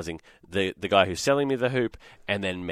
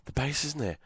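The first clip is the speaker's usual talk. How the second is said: hushed, anxious thinking aloud; worried self-talk; 8 dB quieter than usual. Retold or imitated. Dad fearing he was ripped off, quietly worried. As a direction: drop much softer, as if fretting privately. Retold worried thoughts, base missing.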